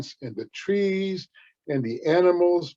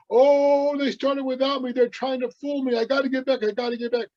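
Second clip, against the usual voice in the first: higher, alarmed realization; alarmed Coyote; 7 semitones higher than usual. Acted. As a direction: Coyote panics at being fooled. Coyote realizes he's tricked.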